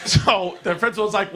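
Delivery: usual speaking voice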